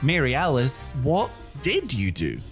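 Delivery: usual speaking voice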